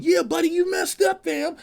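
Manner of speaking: high squeaky gloating voice; high cartoon monster boast; Godzilla trash talk. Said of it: "you got Godzilla going".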